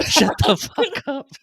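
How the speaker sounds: high, loud laughing shut up; mock outrage through laughter; laughing retort. Real, not acted.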